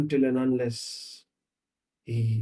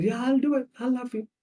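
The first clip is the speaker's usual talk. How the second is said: much higher, eager promise; acted-out eager suitor, pitched up; eager agreement; 10 semitones higher than usual. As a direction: agree readily in a high voice. Man eagerly agreeing to love her.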